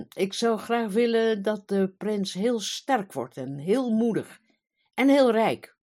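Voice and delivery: pompous king proclaiming, loudest on rijk; grand proclamation. Acted. King grandly states his wish.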